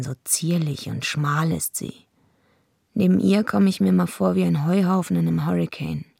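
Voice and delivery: ordinary delivery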